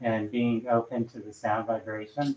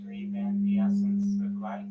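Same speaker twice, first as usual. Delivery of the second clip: guided meditation; 10 semitones higher, 4 dB quieter than usual. Acted. Leads a sound bath meditation.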